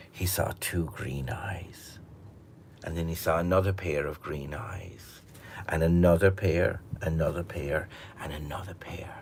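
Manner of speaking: soft whispered narration; hushed suspense. A narrator's reading. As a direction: build dread in the dark.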